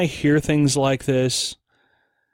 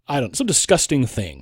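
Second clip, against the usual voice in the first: ordinary delivery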